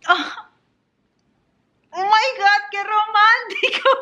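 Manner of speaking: high, breathless swoon; swooning excitement. Real, not acted. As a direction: squeal like a fan who can't take it. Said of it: "voy a llorar".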